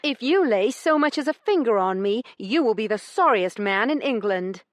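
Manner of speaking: high, loud warning; cold threat. A narrator's reading. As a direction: threaten him fiercely. Heroine threatens.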